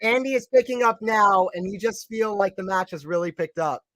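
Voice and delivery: lively play-by-play commentator voice; hint of a broadcast tennis commentator; sports commentary. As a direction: call the match like a TV commentator.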